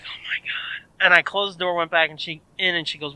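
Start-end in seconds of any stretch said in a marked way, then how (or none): 0.0-0.9 whispered exasperation, retold; dismayed whisper. Teller reacting to neighbour yelling for mom, dismayed whisper. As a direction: whisper it under your breath in dread.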